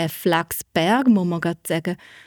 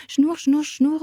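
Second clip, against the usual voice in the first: spinning wheel whir; 8 semitones higher, slower than usual. Acted. Imitated spinning wheel sound.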